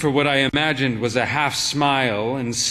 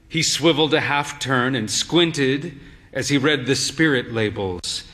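ordinary delivery

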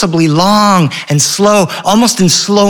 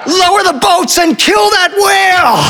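high-pitched mock battle cry; screaming. Son ordering the whale hunt, high acted scream. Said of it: "And Henry screams".

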